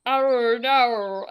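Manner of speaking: mock Aussie "oh no" with heavy R; Australian accent attempt. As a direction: stretch it to "oh naur", Australian style. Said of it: "I need to put more R in it".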